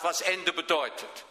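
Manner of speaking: ordinary talk, nothing marked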